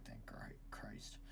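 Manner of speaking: whispered relief under breath. Real, not acted. Relieved muttered aside.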